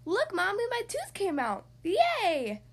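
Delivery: high, loud and thrilled; excited child. Acted. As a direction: squeal it out with joy. Child excited about lost tooth.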